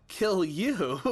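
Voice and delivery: high, loud mocking laugh; eerie child laugh. Ghost son mocking his father, laughing high. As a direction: laugh like something not quite a child.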